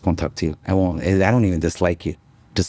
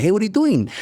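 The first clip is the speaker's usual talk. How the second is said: high, playful phone greeting; funny greeting; 11 semitones higher than usual. Retold or imitated. To a caller, joking high-pitched greeting. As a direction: tease them with a bright hello. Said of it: "I say it in a funny sense".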